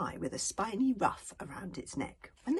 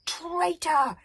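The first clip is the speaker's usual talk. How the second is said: much higher, louder accusing yell; 12 semitones higher, 11 dB louder than usual. Acted. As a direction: hurl the accusation at Alvin. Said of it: "yelled Fishlegs".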